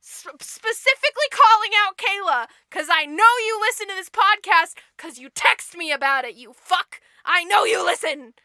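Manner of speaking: loud, playful call-out to a friend; single out Kayla loudly, half-joking; loud teasing shout at a listener; calling someone out. Real, not acted.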